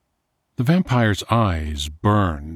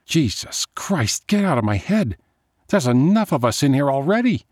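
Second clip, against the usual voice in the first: higher, rising exasperated cry, faster at the end; frustrated outburst; 8 semitones higher, faster than usual. A narrator's reading. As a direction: shove the voice out of her head. Sonia yells at voice in head.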